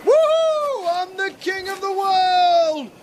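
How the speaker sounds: high, whooping victory yell; jubilant shout. Acted. Man celebrating that she stayed the night, jubilant shout. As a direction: holler it to the world, drawn out.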